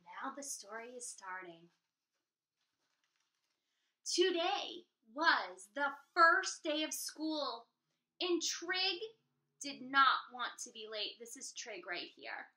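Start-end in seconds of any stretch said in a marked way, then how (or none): 4.0-11.2 put-on lively read-aloud for kids; storytime narration. A narrator's reading. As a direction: read it like to a class. Reading to children.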